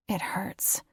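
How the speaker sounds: whispered, hurt admission; hushed ache. A narrator's reading. Narrator confessing she misses Emmett in a hushed whisper. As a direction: breathe it out like a secret pain.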